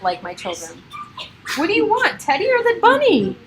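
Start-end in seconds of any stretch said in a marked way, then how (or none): 1.6-3.4 much higher sing-song pet voice; pet talk. Real, not acted. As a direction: coo at the animals. Talking to her pets.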